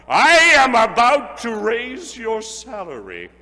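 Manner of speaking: starts stern, swells to a loud high burst; building to a joyful shout. A narrator's reading. Scrooge springing the raise on Bob, rising to a loud happy shout. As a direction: wind up the fake scolding then explode with joy.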